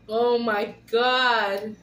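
normal talking voice

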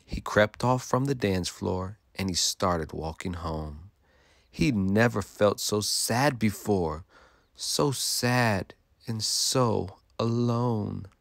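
ordinary delivery

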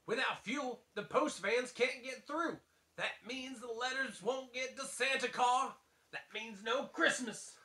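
a hint of a southern Sheriff; southern accent. Sheriff warns townsfolk, mildly southern accent. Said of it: "I'm a little too far southern".

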